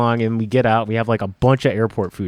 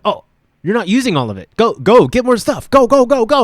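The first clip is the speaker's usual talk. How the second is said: higher, louder hurried go go go; urgent shooing; 9 semitones higher, 6 dB louder than usual. Retold or imitated. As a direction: shoo her back to the aisles, pressing hard. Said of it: "She's like".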